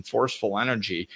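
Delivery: ordinary talk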